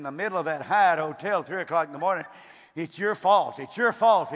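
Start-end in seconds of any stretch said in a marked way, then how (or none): 2.7-4.3 loud accusing shout, pitch jumping up; retold angry yell. Angry husband yelling at the preacher in the hotel, loud accusing shout. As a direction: yell the blame at him. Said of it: "he's screaming at me".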